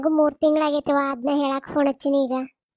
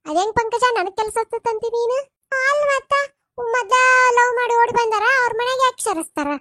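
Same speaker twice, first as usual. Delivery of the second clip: gossipy neighbour; 7 semitones higher than usual. Acted. Neighbour stirs trouble with Girija, much higher scandalised voice. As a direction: stir up trouble with shrill outrage.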